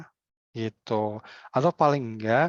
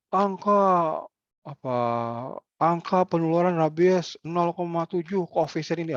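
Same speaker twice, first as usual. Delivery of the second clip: stiff official reciting figures; 5 semitones higher than usual. Retold or imitated. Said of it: "Alah, nggak gitu caranya".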